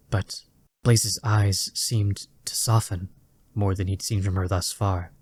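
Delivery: plain reading voice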